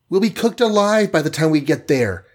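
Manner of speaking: high-pitched, fearful heroine voice; high, worried cry; alarmed gasp. A narrator's reading. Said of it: "gasped the Wasp".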